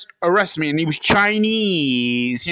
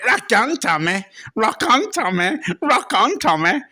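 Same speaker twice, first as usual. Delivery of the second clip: Bobby Ball; 2 semitones higher than usual. Acted. Impressionist greeting a moustached stranger, comic catchphrase impression. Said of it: "pretended that he was Bobby Ball".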